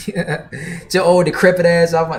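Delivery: hammed-up cranky shoo-off, teasing; playful fake scolding of the chatter; mock grumpy scolding. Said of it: "nah, just playing".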